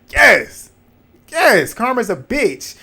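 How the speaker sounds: high and loud yes, then a slow savouring karma's a bitch; gleeful gloating. Retold or imitated. Cheering a cast member getting karma, gleeful gloating. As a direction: relish it like justice was served.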